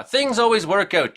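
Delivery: high, mocking cheery platitude; mocking singsong. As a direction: parrot a hollow saying back. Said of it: "repeat empty sayings to me like".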